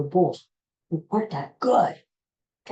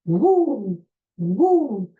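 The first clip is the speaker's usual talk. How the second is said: a touch louder whoop; Ric Flair woo; 5 semitones higher, 5 dB louder than usual. Retold or imitated. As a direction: double wrestler whoop. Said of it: "That was his thing".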